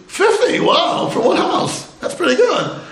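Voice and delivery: high-pitched, amazed echo; impressed surprise. Retold or imitated. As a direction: react wowed by the big number. Retold amazed reaction to number.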